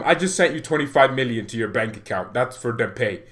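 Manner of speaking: ordinary delivery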